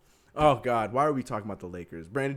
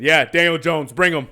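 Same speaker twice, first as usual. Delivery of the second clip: loud mock general manager, much louder; 5 semitones higher, 13 dB louder than usual. Host mocking the Giants GM, loud and brash. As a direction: blurt it out like a clueless GM. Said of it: "My man said".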